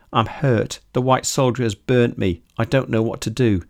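ordinary delivery, nothing marked

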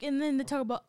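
ordinary talk

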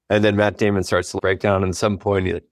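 normal talking voice

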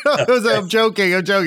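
laughing it off after a bad joke. Real, not acted. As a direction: giggle out the take-back.

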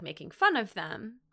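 ordinary talk, nothing marked

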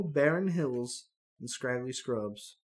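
usual speaking voice

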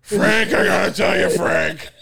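loud throaty boss impression; cartoon boss. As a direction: call out like chicken is lodged in your throat. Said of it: "he's got the chicken lodged in his throat".

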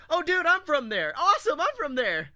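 high, loud happy recognition; light up meeting a hometown stranger; excited. Retold or imitated. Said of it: "I was like".

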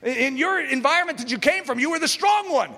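loud, high-pitched tempting voice; the flesh nagging a believer, loud and high. Acted. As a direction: shout like the inner voice pulling you back. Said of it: "that voice is loud".

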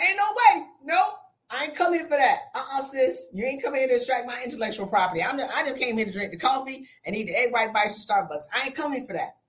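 high, sassy brush-off; flat-out refuse her with attitude; mock-indignant refusal. Retold refusal, acted.

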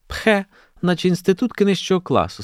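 high, haughty schoolgirl voice; scornful. A narrator's reading. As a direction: look down on them, pitched high. Schoolgirl sneers at the students.

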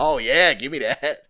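loud flirty tease; flirty mock voice. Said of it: "You're like".

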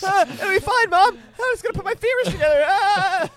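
high, loud, strained mock agony; hammed-up child in pain. Podcaster playing injured boy reassuring his Mom, high-pitched mock agony. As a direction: squeal cheerful reassurance through exaggerated pain. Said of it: "It's like that, guys. That's in the audiobook".

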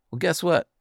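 ordinary talk, nothing marked

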